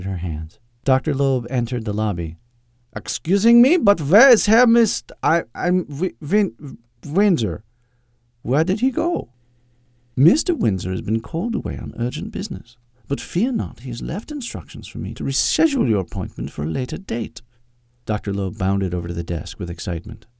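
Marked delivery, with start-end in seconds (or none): 3.0-9.3 high-pitched, hammed-up Austrian accent, stumbling over the name; horrible Austrian accent. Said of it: "such a horrible Austrian accent". 10.2-17.4 higher-pitched British secretary putting off a visitor; smooth official brush-off in a proper English voice; prim English secretary, formal. Acted. Voices Agnes in the story.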